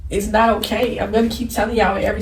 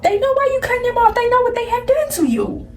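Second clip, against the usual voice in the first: tearful high break; 13 semitones higher than usual. Real, not acted. Vlogger pleading with hurt viewers, voice cracking high. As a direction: voice jumps high and cracks with emotion. Emotional rant about toxic family.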